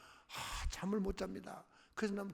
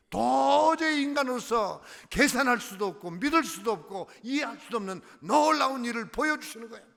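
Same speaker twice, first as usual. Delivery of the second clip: preaching at full force; 7 semitones higher, 9 dB louder than usual. Real, not acted. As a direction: lift the line like a sermon climax. Preaching climax.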